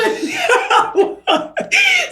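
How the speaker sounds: a high squealing burst of laughter; shrieking laugh. Real, not acted. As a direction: cackle at your own nerve. Laughing at her own retort.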